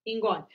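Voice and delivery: a gentle cough then a quiet engole; soft cough demo. Shown as a demonstration. Doctor repeating the soft cough for her audience. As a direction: cough softly and swallow. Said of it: "tosse com um pouco mais de suavidade".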